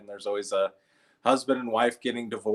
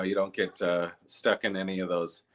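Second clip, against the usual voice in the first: normal talking voice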